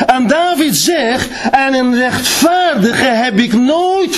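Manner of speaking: high, ringing preacher's proclamation; preaching at full force. Acted. Preacher to his congregation, preaching at full force.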